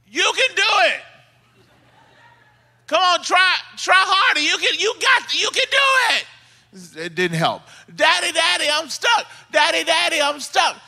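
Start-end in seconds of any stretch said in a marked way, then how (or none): 0.1-7.1 high, hollered cheering-on; encouraging dad. Retold or imitated. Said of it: "he tries to encourage him out". 7.9-10.8 high-pitched mock yell of a stuck boy; screaming child. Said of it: "he's screaming from the backyard".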